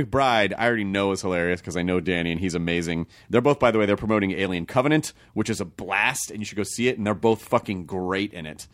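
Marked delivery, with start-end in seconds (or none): none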